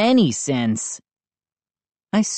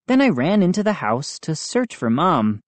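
ordinary delivery

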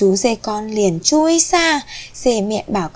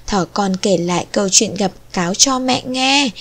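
ordinary delivery, nothing marked

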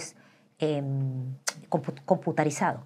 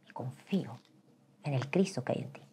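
tender hushed trust; quiet loving reassurance; soft whisper; 3 semitones lower, 6 dB quieter than usual. Retold or imitated. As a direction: whisper gently. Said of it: "husband's quoted words".

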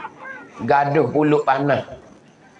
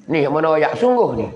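ordinary delivery

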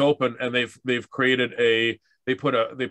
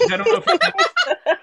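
laughing; 5 dB louder than usual. Real, not acted. Laughing after the sung line.

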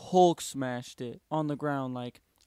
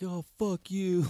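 higher, drawn-out mock cursing; slow, raised mock yell; retold angry yell; 5 semitones higher, 4 dB louder, slower than usual. Son yelling at dad on waking, hammed-up angry cursing. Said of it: "just straight yelling at him".

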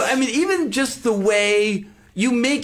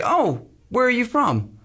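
higher, drawn-out stranger's question; curious local; 4 semitones higher than usual. Retold or imitated. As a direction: imitate an inquisitive local, slowly and pitched up. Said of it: "And somebody would say".

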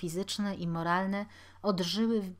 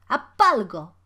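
very loud, sudden order; shouted command; 2 semitones higher, 13 dB louder than usual. A narrator's reading. Older boy ordering stones thrown at Jakub, shouting. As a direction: urge him on with a shout. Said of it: "zawołał starszy".

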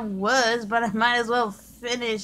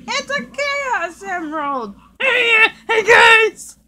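excited shout; 9 semitones higher, 9 dB louder, slower than usual. Acted. Excited shouting over emerald.

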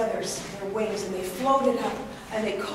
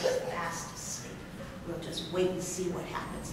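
softer, hushed and sly; sneaky hush; 6 semitones lower, 10 dB quieter than usual. Acted. Fox whispering beside the sleeping bear. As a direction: keep it down so he won't wake.

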